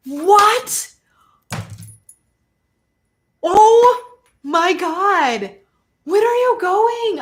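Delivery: high, loud burst of amazement; real astonished excitement. Real, not acted. Reader learns viewers are going to Sedona, astonished excitement. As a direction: freak out with delighted disbelief. Astonished that her guess came true.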